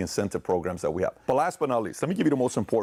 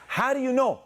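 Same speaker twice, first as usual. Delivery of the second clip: put-on much higher indignant child's question; protesting kid; 3 dB louder than usual. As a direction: challenge him like a wronged boy. Son protesting.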